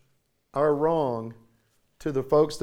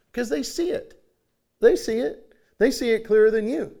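emphatic; 7 semitones higher, 5 dB louder than usual. Acted. Preacher presses his point.

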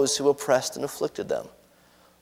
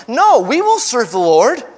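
higher, louder voice of the people; raised, declarative vow; emphatic pledge; 9 semitones higher, 6 dB louder than usual. Acted. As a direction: insist as a crowd swearing loyalty. People pledge loyalty to God.

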